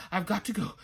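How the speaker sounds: unvoiced, panting words; breathless whisper. A narrator's reading. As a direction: push it out between breaths. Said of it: "and he breathed".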